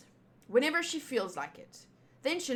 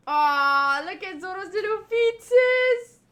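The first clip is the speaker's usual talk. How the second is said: cutesy cooing; 8 semitones higher, 9 dB louder, slower than usual. Real, not acted. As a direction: coo over something adorably tiny, high and loud. Cooing over cute drawn feet.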